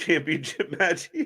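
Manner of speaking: normal talking voice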